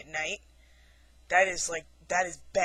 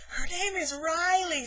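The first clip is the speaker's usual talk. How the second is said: put-on boyfriend's line; 11 semitones higher, slower than usual. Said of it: "and he goes".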